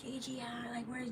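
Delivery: hushed whisper of strangers at the door. Retold or imitated. Strangers at the door whisper asking for Gigi, hushed. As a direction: breathe it, barely voiced, like someone searching. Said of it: "They're like".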